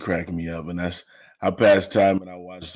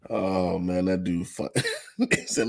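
ordinary talk

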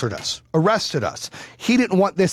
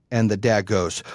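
ordinary delivery

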